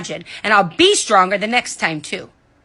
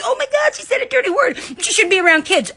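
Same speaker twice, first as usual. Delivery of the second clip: mocking prissy critic; 10 semitones higher than usual. Mocking critics' prudish reaction.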